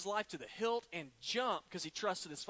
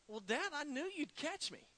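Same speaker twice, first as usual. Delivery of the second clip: imitating a puzzled boy, higher pitched; mildly perplexed; 7 semitones higher, 4 dB quieter than usual. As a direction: play the baffled son answering his dad in a higher voice. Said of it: "his son was totally perplexed".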